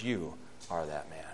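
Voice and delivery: low, very soft confrontation; grave, hushed pronouncement; hushed accusation. Retold or imitated. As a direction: deliver the verdict quietly and low. Nathan confronts David with his sin.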